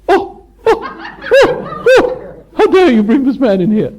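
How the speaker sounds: high, loud, laughing mock outrage from prim churchgoers; scandalised parishioners. Teacher mocking churchgoers shocked by his talk, laughing mock outrage. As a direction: ham up the gasps of offended parishioners. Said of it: "all the parishioners drop their monocles in their soups".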